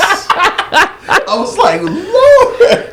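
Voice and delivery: high laughing disbelief. Real, not acted. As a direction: squeal through laughter. Laughing disbelief at ladder story.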